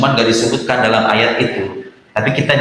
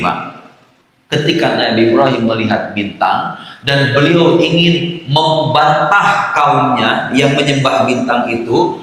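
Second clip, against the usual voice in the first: normal talking voice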